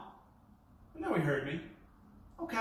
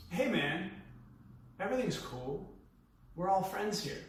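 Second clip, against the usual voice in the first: soft, even, calming tone; relaxed reassuring murmur; calm monotone; 3 semitones lower, 8 dB quieter than usual. Retold or imitated. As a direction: settle the stranger down gently. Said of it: "me being so monotone, relaxed, and calm".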